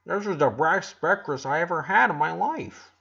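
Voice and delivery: words round a big bite, delighted; mouth full. A narrator's reading. Boy praising breakfast to Ronnie Ann, mouth full. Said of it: "with his mouth full of waffles".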